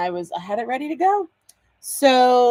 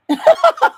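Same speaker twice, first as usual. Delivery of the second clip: a high burst of real laughter; delighted laugh; 9 dB louder than usual. Real, not acted. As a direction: laugh out loud, charmed. Delighted at the name.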